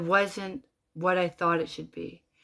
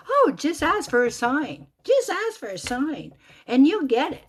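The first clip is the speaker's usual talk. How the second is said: high, singsong mockery; mocking imitation; 8 semitones higher, 5 dB louder than usual. Driver alone in her car mocking the woman's advice. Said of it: "I'm mocking this girl".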